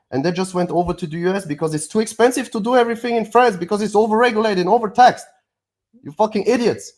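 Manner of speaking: rising from heated to a furious shout; exasperated rant climbing to a loud shout; building angry outburst. Real, not acted. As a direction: let it boil over into yelling. Angry rant about overregulated Europe.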